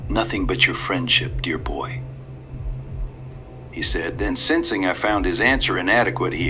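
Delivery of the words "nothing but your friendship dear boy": put-on whispered, sly old man. A narrator's reading. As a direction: breathe it like a secret offer.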